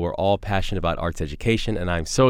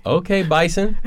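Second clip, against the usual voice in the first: excited; 10 semitones higher than usual. Real, not acted. Host cheers guest's college pick.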